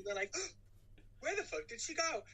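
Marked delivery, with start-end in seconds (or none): 0.4-1.2 exaggerated gasp of surprise; mock shocked gasp. Imitating characters' shock at disappearance. 1.2-2.2 high-pitched imitation of shock; mock bewilderment. Acting out characters' shock at disappearance.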